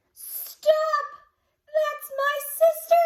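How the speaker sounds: high, loud frantic yell; desperate shout. Acted. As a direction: cry out to save her. Boy protects his sister.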